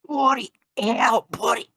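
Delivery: high, loud imitated yelling; mock shout. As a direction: shout it like a cut-off scream. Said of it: "you went".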